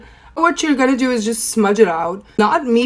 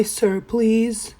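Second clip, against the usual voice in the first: ordinary talk, nothing marked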